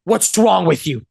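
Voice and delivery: slow, aggressive attack; worst tonality. Shown as a demonstration. Coach showing worst tone and wording, attacking. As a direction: lean hard and slowly into the accusation. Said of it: "the worst tonality with the worst wording".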